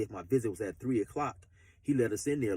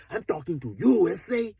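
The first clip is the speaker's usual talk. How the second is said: loud, higher hard-edged threat; gangster menace; 6 semitones higher, 8 dB louder than usual. Retold or imitated. Gangster confronting the new inmate, loud threat. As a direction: snap it like a yard bully. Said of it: "straight gangster shit".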